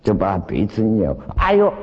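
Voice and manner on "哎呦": a sharp, higher "ouch" cry, half acted; mock yelp of pain. Master retelling the disciple's cry as his nose is twisted, mock yelp. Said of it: "他一叫".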